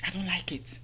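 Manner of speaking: hushed confidential whisper; under the breath, voiceless. Real, not acted. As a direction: whisper it like a secret. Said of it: "let's whisper it".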